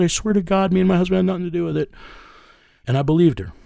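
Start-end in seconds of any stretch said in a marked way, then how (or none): 0.0-1.9 raised-pitch imitation of a sobbing, pleading mother; begging mother. Mother begging the detective on the phone, high pleading voice. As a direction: play the desperate mother begging on her knees. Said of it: "and she was begging me".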